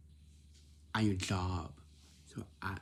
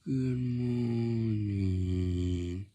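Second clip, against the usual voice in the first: very slow, yawned-out greeting; mock sleepy, drawn-out yawning voice; just out of bed; 3 dB louder, much slower than usual. Shown as a demonstration. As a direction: stretch it right out as if barely awake. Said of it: "You sound like this".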